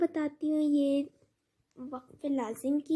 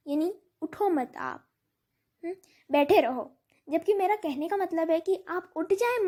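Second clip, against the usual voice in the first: normal talking voice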